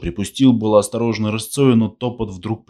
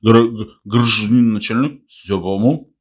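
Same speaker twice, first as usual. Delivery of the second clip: higher, louder stumbling address; stammering drunk; 4 semitones higher, 6 dB louder than usual. A narrator's reading. Long man reports to the lieutenant, stammering drunk. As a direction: trip over the first syllable, then reassure.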